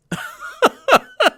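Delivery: very high laughing squeal; high giggle burst. Real, not acted.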